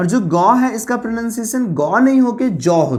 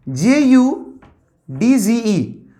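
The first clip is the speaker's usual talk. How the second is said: ordinary delivery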